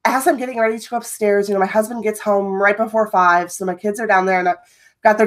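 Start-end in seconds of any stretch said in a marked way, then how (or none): none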